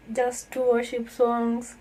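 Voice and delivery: ordinary talk, nothing marked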